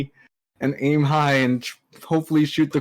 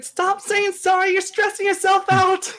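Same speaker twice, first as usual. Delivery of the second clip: shouted reply. Acted. Character replies to the apologizer, high exasperated call. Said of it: "I'm just gonna reply back".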